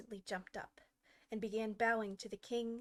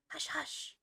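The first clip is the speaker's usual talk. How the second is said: whispered urgent hush; low, hurried; 6 semitones higher than usual. Acted. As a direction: hiss it quickly, afraid to be heard. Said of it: "in a low, hurried tone".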